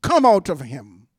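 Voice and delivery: low, forceful order; stern. A narrator's reading. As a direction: command it out firmly. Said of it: "said Jesus sternly".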